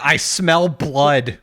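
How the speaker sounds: very slow, drawn-out mock proclamation; ominous quote. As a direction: stretch out each word as if catching a scent. Quotes Power sensing a devil.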